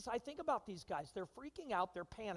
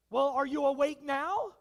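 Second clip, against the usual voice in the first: much louder, pointed; mock loud nudge to a dozing spouse; loud wake-up call; 4 semitones higher, 13 dB louder than usual. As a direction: jab the sleeper awake. Waking the spouse.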